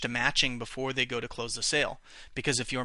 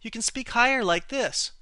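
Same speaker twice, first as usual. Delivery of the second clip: much higher demonstration voice; higher pitch; 9 semitones higher than usual. Shown as a demonstration. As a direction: lift the voice up high to show it. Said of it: "If they're very high".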